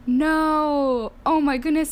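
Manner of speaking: a re-enacted stunned no; retold surprise. Bride seeing the kneeling fiancé, high and surprised. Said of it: "and I'm like".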